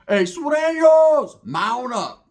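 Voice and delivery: high, drawn-out holler to the crew; rallying call. Retold or imitated. Cousin summoning his homeboys to ride out, imitated rallying holler. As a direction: imitate a loud, slow call to arms.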